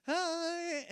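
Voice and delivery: high, drawn-out sing-out hello; mock cheery greeting. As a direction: imitate a breezy goodbye wave, way up high. Pastor's wife waves as van leaves.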